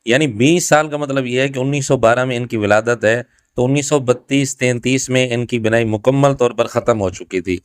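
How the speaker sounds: usual speaking voice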